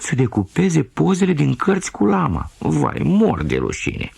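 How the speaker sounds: a mortified mother cat pouring out apologies; mother cat apologising for her naughty kitten, ashamed and wailing. Acted. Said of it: "miuna rușinată".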